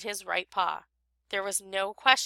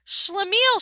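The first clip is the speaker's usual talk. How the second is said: high, loud shriek of his name; 11 semitones higher, 4 dB louder than usual. A narrator's reading. Wife shrieking at her late husband, high shriek. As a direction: shriek it at the door. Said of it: "she shrieked".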